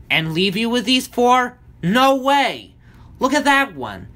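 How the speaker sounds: high, loud protest; crying out. A narrator's reading. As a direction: cry out in indignant refusal. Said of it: "Smurf Storm cried".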